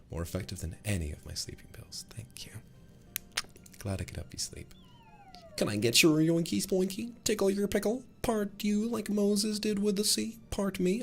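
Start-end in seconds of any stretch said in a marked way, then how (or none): 5.6-11.0 very high cartoonish mock flirting; silly squeaky voice. Streamer mock-flirting with chat, silly squeaky voice. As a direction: squeak the nonsense pickup lines.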